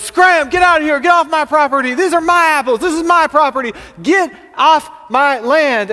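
high, loud shouting farmer; full-voiced angry holler; furious yell. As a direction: yell to chase trespassers off your land. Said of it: "and he yells".

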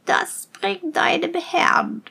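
high, slow witch's voice; wicked witch. A narrator's reading. Witch handing the servant a poisoned drink, crone voice. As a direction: creak it out slowly as an evil crone. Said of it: "die böse Hexe".

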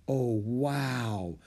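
awed, breathy hush; breathless awe; breathed. A narrator's reading. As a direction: let the wonder out on a breath. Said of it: "she breathed".

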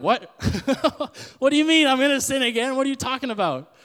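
high, loud laughing "what?"; laughing disbelief. Retold or imitated. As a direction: laugh out your disbelief, half mocking. Listener's incredulous reaction, laughing.

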